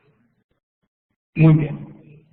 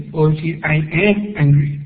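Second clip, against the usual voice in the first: normal talking voice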